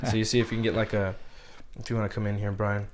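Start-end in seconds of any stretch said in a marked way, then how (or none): none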